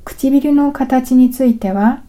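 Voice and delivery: normal talking voice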